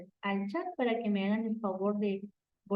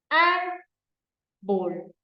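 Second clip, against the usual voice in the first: Árbol called out louder and higher; example word; 5 semitones higher, 5 dB louder than usual. Shown as a demonstration. Teacher showing students the example word Árbol, emphatic. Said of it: "Es la palabra que tenemos acá".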